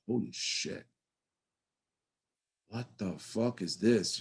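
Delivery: slow, low-key retold disbelief; quietly stunned, dragging the words out; hushed amazement. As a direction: take it in slowly, under your breath. Retelling first sight of club.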